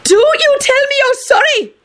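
high-pitched furious outburst; enraged roar. A narrator's reading. McGonagall shoving Lupin in fury, enraged roar. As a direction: rage at him shrilly. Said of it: "McGonagall roared".

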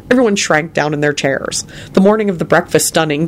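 ordinary delivery

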